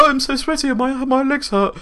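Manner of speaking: high, whiny mocking voice; mock her breathless complaining; mocking whiny actress. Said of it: "She was like".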